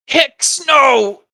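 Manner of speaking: high anguished cry; kobold crying out. Acted. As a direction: scream a comrade's name. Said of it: "you see the other one call out".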